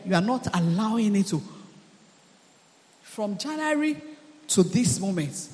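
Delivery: normal talking voice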